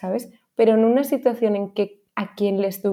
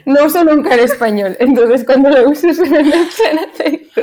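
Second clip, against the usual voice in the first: laughing; 5 semitones higher, 7 dB louder than usual. Real, not acted. Laughing at her own accent.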